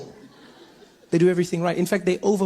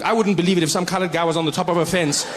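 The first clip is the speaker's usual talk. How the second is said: ordinary talk, nothing marked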